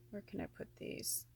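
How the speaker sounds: whispered to herself; whispered aside. Real, not acted. Placing crystals for viewers to see, whispered. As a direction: murmur under your breath while arranging. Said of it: "aside while placing crystals".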